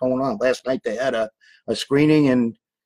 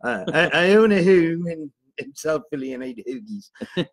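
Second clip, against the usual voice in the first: South Philly accent; 4 semitones higher than usual. Shown as a demonstration. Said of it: "get my South Philly accent on".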